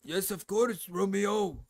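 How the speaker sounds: high-pitched put-on Japanese accent; mock Japanese accent. Shown as a demonstration. Streamer playfully obliges a viewer, mock Japanese accent. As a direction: answer in a caricatured Japanese accent. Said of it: "Can you do a racist Japanese accent".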